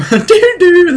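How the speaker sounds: high, loud, laughing sung doorbell ding-dong; doorbell chime. Acted. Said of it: "what it would fucking sound like".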